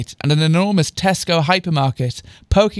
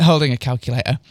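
plain reading voice

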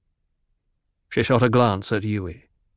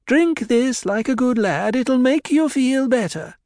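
high, coaxing voice of the doctor; 14 semitones higher, 4 dB louder than usual. A narrator's reading. Doctor coaxing drunk Idaho to drink, high and soothing. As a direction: urge him gently like a good lad. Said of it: "He spoke reasonably".

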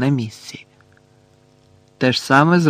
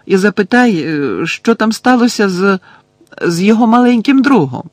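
loud, high-pitched shout after someone; calling out; 8 semitones higher, 6 dB louder than usual. A narrator's reading. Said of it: "крикнула Герміона, коли Гаррі вже виходив".

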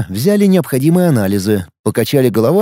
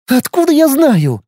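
angry retort; 12 semitones higher than usual. A narrator's reading. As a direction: snap back in irritation. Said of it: "разозлилась я". (сердито, с раздражением)

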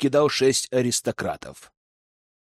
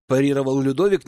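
plain reading voice

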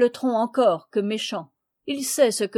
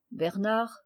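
usual speaking voice

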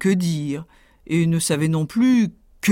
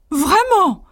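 much higher, louder tailor voice; mock surprise; 13 semitones higher, 5 dB louder than usual. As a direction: feign cheeky surprise. Tailor answers the insult.

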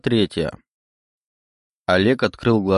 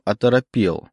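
plain reading voice

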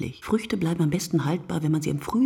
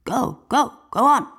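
loud, higher drill shout at running soldiers; sergeant urging on; 6 semitones higher, 5 dB louder than usual. A narrator's reading. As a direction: bark them forward, sergeant. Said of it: "angefeuert von ihrem Sergeant".

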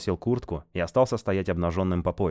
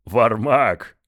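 laughing exclamation; 4 semitones higher, 8 dB louder than usual. Acted. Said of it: "Мангус расхохотался".

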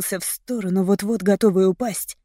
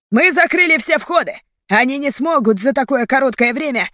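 loud, higher protesting shout; angry disbelief; 6 semitones higher, 4 dB louder than usual. A narrator's reading. Leader disputing the police warning, angry shout. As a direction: argue it back heatedly.

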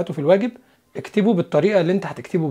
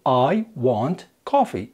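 slow careful; 2 semitones lower, much slower than usual. Shown as a demonstration. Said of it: "الجملة قبل الاختصار المفروض".

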